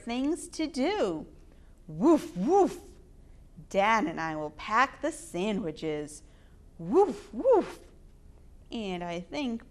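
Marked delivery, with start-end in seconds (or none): 1.9-2.8 puppy barks. Acted. Puppy Biscuit barking in story. 6.8-7.8 puppy barks. Acted. Puppy Biscuit barking in story.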